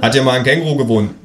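a hint of a pushy policeman; police officer. Police at the door asking to come in, lightly voiced officer.

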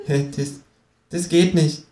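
high, baffled kangaroo; a baffled kangaroo protests; disconcerted. A narrator's reading. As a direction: sound thrown and puzzled. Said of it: "sagt es konsterniert".

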